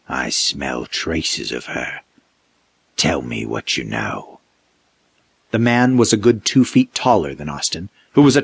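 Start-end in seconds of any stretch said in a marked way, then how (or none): 0.1-4.4 low, very slow sniffing threat; menacing creature. A narrator's reading. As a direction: draw each word out.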